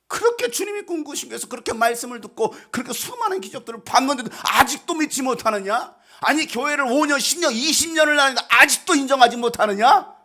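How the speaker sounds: high-pitched, insistent rebuke; reproachful scolding. Acted. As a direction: pile up the reproach, swelling at the end. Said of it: "예수님께서 책망하지 않을까요". (높은 목소리로 몰아붙이며 책망)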